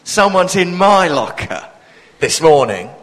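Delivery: mock annoyance. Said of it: "I do this".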